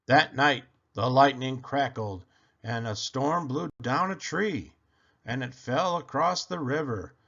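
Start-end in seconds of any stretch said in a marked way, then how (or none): none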